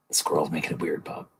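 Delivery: soft, muted awkward deflection; low-key, softly blaming the squirrel; quiet aside. Acted. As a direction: say it under your breath. Acted awkward exchange with Bob Ross.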